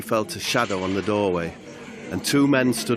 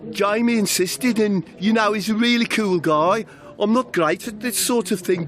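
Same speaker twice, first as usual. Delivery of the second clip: much higher, bright-toned hostess voice; chirpy Demaria; 10 semitones higher than usual. Acted. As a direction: fuss over him like a cheerful waiter. Demaria voice continues.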